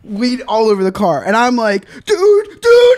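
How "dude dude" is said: high, rising alarm; high alarmed calling. Retold or imitated. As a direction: call out high and louder. Waking up alarmed.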